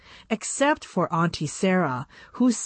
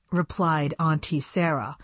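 plain reading voice

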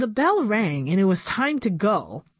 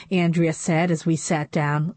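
plain reading voice

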